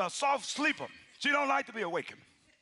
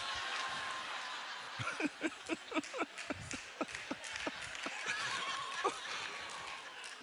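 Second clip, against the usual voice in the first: breathy laughter rising up; long laugh; 7 semitones higher, 9 dB quieter than usual. Real, not acted. Preacher laughing with the congregation, long breathy laughter. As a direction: laugh out at the memory.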